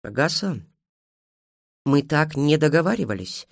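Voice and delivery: cold, restrained reproach. A narrator's reading. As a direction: hold back the volume, stay icy. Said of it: "холодно сказала Эйджел". (холодно, сдержанно)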